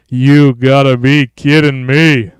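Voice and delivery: slow, punched-out mock exclamation; hammed-up disbelief. Streamer reacting to the new look, hammed-up drawn-out disbelief. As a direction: drag out every word with force. Repeats line, drawn out and louder.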